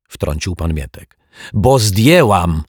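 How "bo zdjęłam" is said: louder, slow and defiant; stubborn foot-stamp retort. A narrator's reading. Mrs Majewska snapping back at Mr Mietek, defiant and loud. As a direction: plant every word like a stamped foot. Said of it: "tupnęła nogą pani Majewska".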